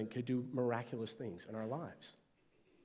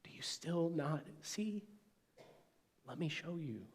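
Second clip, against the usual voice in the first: long-suffering love; 4 semitones higher, 7 dB quieter than usual. Retold or imitated. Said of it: "long-suffering love".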